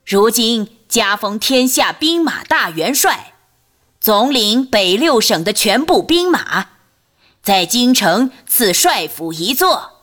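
high, loud, drawn-out court proclamation; eunuch's thin voice. A narrator's reading. Eunuch Zhao reads the imperial edict to the court, thin high voice. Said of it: "赵公公那尖细的嗓子继续念叨".

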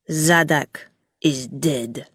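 slow, low, cold statement; cold announcement. A narrator's reading. Gog watching Rilla's reaction, cold. Villain announces a death coldly.

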